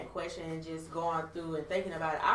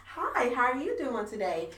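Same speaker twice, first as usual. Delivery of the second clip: lightly put-on chirpy Becky greeting; 5 semitones higher than usual. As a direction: mom mock-switching into a Becky voice for a greeting. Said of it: "that Becky on you".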